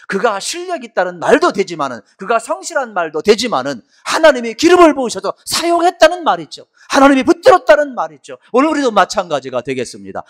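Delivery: rising, impassioned sermon climax; preaching at full force. Real, not acted.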